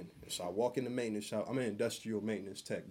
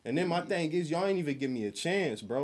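higher, louder indignant rant; heated retold rant; 5 semitones higher, 5 dB louder than usual. Venting to friends about a coworker, louder heated rant. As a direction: vent it like you're still mad.

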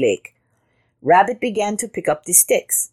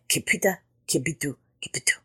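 soft pattering footfall noises; footsteps fading away; 7 dB quieter, faster than usual. A narrator's reading. Jackal trotting off, soft fading patter. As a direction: patter it quietly, dying away.

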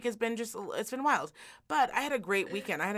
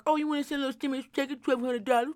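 mock Bezos voice; 4 semitones higher, faster than usual. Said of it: "I am gonna make you".

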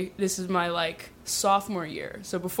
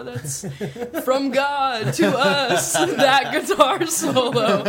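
slow, awed mock worshipper; drawn-out reverent mockery; laughing mock-awed quote; mock reverence; 5 semitones higher, 3 dB louder, much slower than usual. Mocking worshippers.

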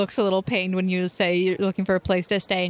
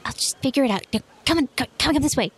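put-on eager girl; 6 semitones higher, much faster than usual. GM as Cece urging party.

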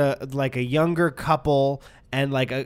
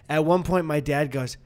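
normal talking voice

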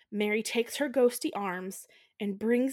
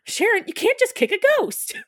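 much higher and louder, hammed-up scolding; mock exasperated man; 12 semitones higher, 8 dB louder than usual. Steve Guttenberg telling Sharon off, hammed-up squeal. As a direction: squeal it out like a flustered guy. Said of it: "Steve Guttenberg says".

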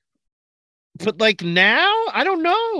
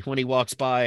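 ordinary delivery

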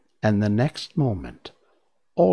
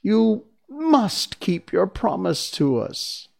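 exclaiming; 10 semitones higher, slower than usual. Acted. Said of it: "exclaimed Dorothy".

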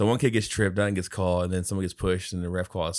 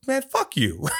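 higher fuck you; mock angry dad; 9 semitones higher, slower than usual. Dad swearing at the ref, mild mock anger. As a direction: fling it back. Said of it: "he goes".

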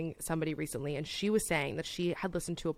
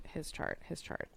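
whispered; 10 dB quieter than usual. Real, not acted. Dreading reading his chart.

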